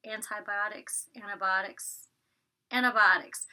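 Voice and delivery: "antibiotics" as an-uh-bah-tiks, T's dropped; "antibiotics" with a relaxed Georgia twang, T's swallowed; Southern pronunciation. Shown as a demonstration. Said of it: "I say antibiotics".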